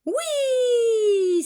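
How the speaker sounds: very high, loud shouted yes; a whole class yelling yes; class cheering. Acted. Pupils cry out yes to the beach trip, high shout. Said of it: "s'écrie la classe".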